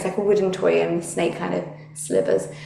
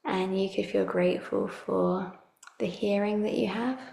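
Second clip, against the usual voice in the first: calm meditative tone; 7 dB quieter than usual. Acted. Leads a guided relaxation.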